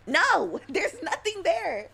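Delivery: loud, high drawn-out protest; mock outcry. Streamer protesting in a voice bit, mock shouting. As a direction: cry it out with playful exasperation.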